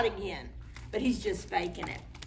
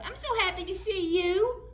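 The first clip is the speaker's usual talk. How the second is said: high doting mom; 10 semitones higher than usual. Acted. Mom voice continues.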